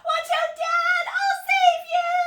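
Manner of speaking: very high, loud panicked scream. A narrator's reading. Emily warning Dad of the dinosaur, screaming. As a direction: scream out to warn her dad. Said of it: "Then I scream".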